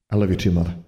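ordinary delivery, nothing marked

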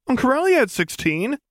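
put-on high, pleading teen argument; a boy trying one last excuse on Mom; arguing teenager. Teen arguing with his mother.

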